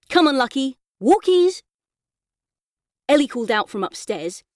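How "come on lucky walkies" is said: calling the dog. A narrator's reading.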